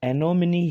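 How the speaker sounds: "एनोमनी" as e-no-mi-ni; correct pronunciation. Shown as a demonstration. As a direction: sound the final e as ee in "एनोमनी". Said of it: "वो सही है". ("एनोमनी" के अंत में ई की आवाज़)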